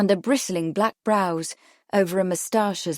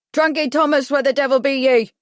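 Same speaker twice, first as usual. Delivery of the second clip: loud, higher hail with a rough edge; bellowing; 7 semitones higher, 7 dB louder than usual. A narrator's reading. Fisherman bellowing up to Trunky Thomas, loud. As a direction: shout it up the cliff at him. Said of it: "he bellowed".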